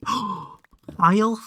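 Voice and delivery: high, loud gasped question; a loud startled gasp; gasping surprise. Real, not acted. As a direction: gasp and squeak it out in shock. Gasp at chat message.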